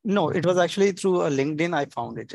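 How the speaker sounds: ordinary talk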